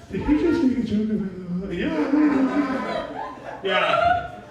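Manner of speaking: high, strangled disbelieving laugh; shocked guy. Retold or imitated. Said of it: "this guy went from this to".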